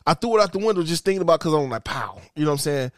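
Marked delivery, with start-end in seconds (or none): 1.8-2.2 low, soft little bang; small imagined pop. Retold or imitated. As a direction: play the firecracker down as a tiny pop. Expected small firecracker pop.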